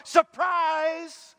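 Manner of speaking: high, loud shout of surprise; spring it on her like a party. Acted. Angel pops up before Mary shouting surprise.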